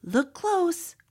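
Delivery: usual speaking voice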